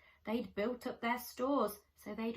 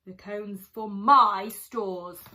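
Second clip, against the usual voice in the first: loud claim; 2 semitones lower, 12 dB louder than usual. Acted. Bruce continues the quarrel.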